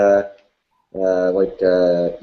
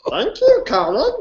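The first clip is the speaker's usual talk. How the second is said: koala voice. Acted. Stays in koala voice.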